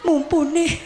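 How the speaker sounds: high, teary mock voice of a gushing fan mother; imitating weeping mother. Teary mother fan gushing to the young preacher, high mock weeping. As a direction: imitate an older lady sobbing out her devotion. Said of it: "Ibu-ibu nangis-nangis begini".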